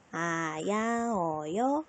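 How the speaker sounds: slow, drawn-out vowels; reciting the alphabet. Shown as a demonstration. Teacher recites Korean vowels as first learned, slowly drawn out. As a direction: chant each vowel out slowly, one by one. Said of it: "우리가 한글을 배울 때".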